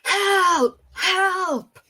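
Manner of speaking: high, loud cry for help; game master acting out a character's shouts; shouting for help. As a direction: call out twice, pitched well up. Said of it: "he will shout out".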